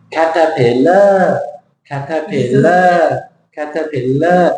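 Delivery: caterpillar drawn out, heavy long LAR at the end; slow caterpillar in full beats, leaning on the last; wrong Thai-style stress. Shown as a demonstration. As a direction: stretch the final syllable of caterpillar. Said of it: "Different shape altogether".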